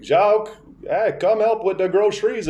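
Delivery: imitated grandma. Grandma nags grandpa to help, high imitated call. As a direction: draw out a high-pitched summons. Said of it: "then she'd be like".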